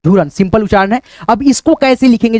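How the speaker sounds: ordinary talk, nothing marked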